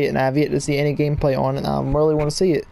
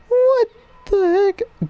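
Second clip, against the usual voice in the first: high pitched startled what the heck; surprised; much slower than usual. Real, not acted. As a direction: squeak out in shock. Surprised by new game mode.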